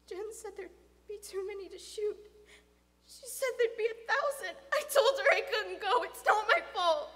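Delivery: panicked protest. Acted. Boy insisting to father he's blameless, panicked. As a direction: defend yourself in rising panic.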